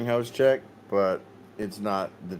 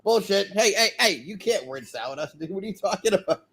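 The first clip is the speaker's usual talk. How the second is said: mocking outrage; 11 semitones higher than usual. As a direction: call out the nonsense, mocking. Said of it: "We're like".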